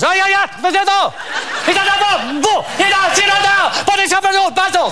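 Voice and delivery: put-on high, excited foreign accent yelling; squealing hotel worker spotting the rat; panicked hotel worker. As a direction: shriek in alarm at the rat. Hotel staff shouting at the rat.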